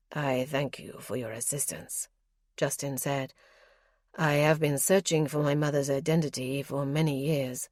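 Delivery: a lightly deepened nobleman reporting calmly; lightly voiced lord, measured and guarded; Justin's voice. Justin's voice in dialogue.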